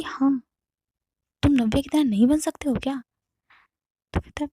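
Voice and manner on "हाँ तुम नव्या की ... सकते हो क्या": angry. Retold or imitated. Wife keeps berating her husband.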